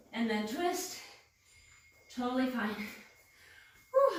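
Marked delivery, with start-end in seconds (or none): 2.8-4.2 high breathy exhale of real exhaustion; winded whew. Real, not acted. Trainer out of breath after the crawl, high winded whew. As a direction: blow it out like you can barely keep going. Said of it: "I'm out of breath".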